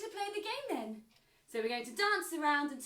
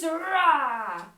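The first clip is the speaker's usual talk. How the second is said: lion roar; 5 dB louder than usual. Shown as a demonstration. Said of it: "Can you show me a lion pose?".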